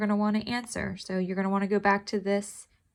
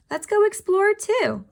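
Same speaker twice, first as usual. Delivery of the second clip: higher and a bit louder; eager call; 11 semitones higher, 6 dB louder than usual. Acted. Toby coaxes Kiki through the fence, eager and higher. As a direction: coax your friend to come along.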